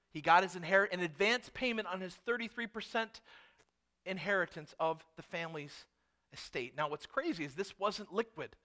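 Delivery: ordinary talk, nothing marked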